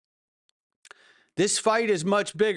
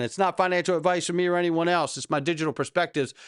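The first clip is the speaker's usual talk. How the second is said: fast disclaimer; faster than usual. Real, not acted. Rushed disclaimer.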